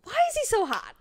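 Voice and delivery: high, incredulous swoon; high-pitched swooning. Retold or imitated. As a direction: let the question leap up high. Same reaction, escalated.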